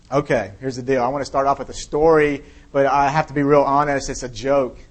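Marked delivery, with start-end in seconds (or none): none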